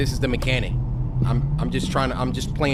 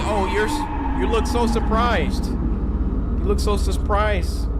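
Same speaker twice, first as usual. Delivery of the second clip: high-pitched taunt tripping over the s; stumbling tough-guy taunt; 7 semitones higher, slower than usual. Real, not acted. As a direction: act tough but stumble on the words. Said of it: "trying to be a tough guy and I'm freaking stuttering".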